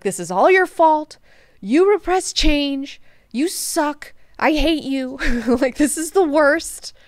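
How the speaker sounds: petulant blaming. Retold or imitated. Duncan blaming Leto for everything, petulant. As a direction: throw accusations in a hammed-up sulk.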